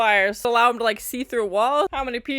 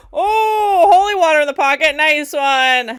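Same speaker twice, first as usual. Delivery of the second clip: loud, high gasp into cheering; excited surprise; 6 semitones higher, 9 dB louder than usual. Real, not acted. Viewer cheering holy water in the pocket, excited surprise. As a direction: start with a high "Oh" and cheer the move.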